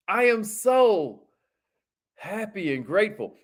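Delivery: heartfelt affirmation. Shown as a demonstration. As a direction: say it like you truly mean it. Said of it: "Say it like you mean it".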